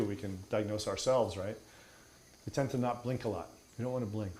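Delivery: ordinary delivery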